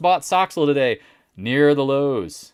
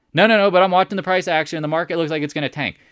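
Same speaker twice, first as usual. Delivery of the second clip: fast, louder rushed rebuttal; rushed objection; 3 semitones higher, 4 dB louder, faster than usual. Retold or imitated. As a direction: blurt it out in a panicky rush. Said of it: "arguing viewer".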